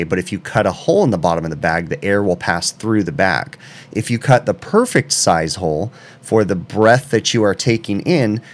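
normal talking voice

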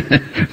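short high sinister chuckle; a brief mocking laugh; amused at his prey; villainous chuckle.

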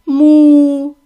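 a cow mooing hard; loud cow moo. Acted. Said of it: "сказали корови".

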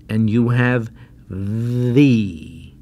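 V said slowly, teeth on lip then open to E; slow demo V. Shown as a demonstration. Teacher demonstrates the full V for learners. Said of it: "say E, like this".

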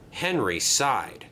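ordinary delivery, nothing marked

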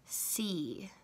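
see whispered softly; teacher whispers the word see. Shown as a demonstration. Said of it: "And the last word is".

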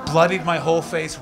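ordinary delivery

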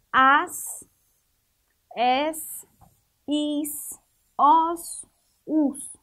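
"as, es, is, os, us" one by one, each ending in a held S; same S sound. Shown as a demonstration. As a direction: separate "as, es, is, os, us" with pauses, drawing out the S. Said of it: "a pronúncia deles é igual". ("as, es, is, os, us" um por um, com o S alongado)

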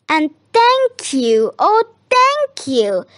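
high, gushing thanks rising on the repeat; grateful cry. A narrator's reading. Witch thanks the animals who saved her, crying out gratefully. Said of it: "the grateful witch cried".

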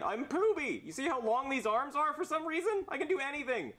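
Pooby character voice. Acted. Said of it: "Can I play Brooklyn Guy and Jeffy".

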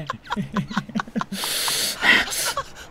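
long high breathy giggle; high-pitched wheezing cackle; high wheezy laugh. Real, not acted. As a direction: let out a squeaky, airy laugh. Laughs after explaining his reasoning.

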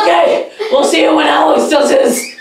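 loud strained laughing under weight; straining. Real, not acted. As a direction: struggle under a heavy load. Doing the worm, opponent on back.

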